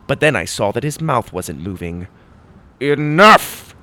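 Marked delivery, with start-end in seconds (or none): 2.8-3.7 loud rising command; loud insistent shout. Acted. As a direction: order him to stop. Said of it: "my sword shouted again".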